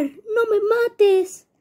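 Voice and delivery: whimpering don't kill me; mock crying plea. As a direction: plead for your life with fake sobs. Begging not to be killed.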